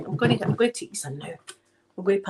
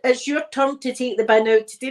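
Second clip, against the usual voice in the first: acting out bin row; 5 semitones higher, 5 dB louder than usual. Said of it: "see you're arguing with someone".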